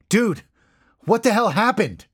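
high, loud, urgent questioning; forceful demand. A narrator's reading. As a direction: demand an answer with real force. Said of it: "I demanded, a little more forcefully".

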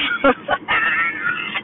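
low laugh trailing out; real laugh. Real, not acted. Vlogger admitting she has a control problem, laughing. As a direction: laugh at yourself.

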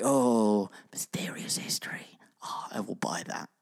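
breathy mock whisper. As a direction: hush it like a secret. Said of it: "if you're like".

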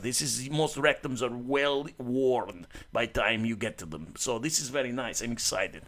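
hammed-up Russian guy accent, held for the bit. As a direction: keep the joking Russian voice going. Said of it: "Very good accent".

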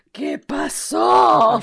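very slow, drawn-out mock yell; hammed-up retold yell; imitating a shout. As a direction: stretch it out like someone yelling. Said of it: "Rebeca salió gritando".

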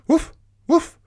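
high, loud little woofs; dog's bark. Acted. Dog calling to the spider, high barking. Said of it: "barked the dog".

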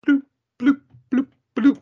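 high squeaky bloop sounds, loud. Retold or imitated. Imitating Kirby floating up with high bloops. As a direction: pop out tiny bloops way up high.

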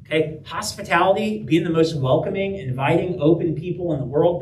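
ordinary talk

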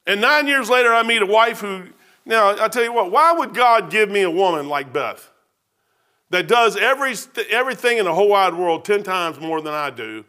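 normal talking voice

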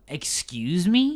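drawn-out, affronted query; incredulous. Real, not acted. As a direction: stretch it out in offended disbelief. Incredulous reaction to a quote.

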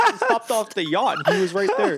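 high, loud hammed-up yacht brag; over-the-top fake boast about the yacht; mock rich guy. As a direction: brag like a spoiled rich kid. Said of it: "That's not what happened at all".

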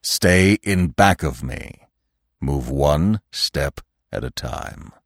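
slow, deliberate orders, dropping low; commanding. A narrator's reading. Old stag making Bambi obey, slow and commanding. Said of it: "a voice that forced Bambi to obey".

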